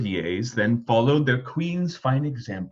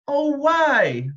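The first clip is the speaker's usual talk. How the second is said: put-on high, louder childish cry; cried; 13 semitones higher, 5 dB louder than usual. A narrator's reading. Prince protesting the magician leaving, crying out. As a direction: protest like a disappointed boy. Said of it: "the prince cried".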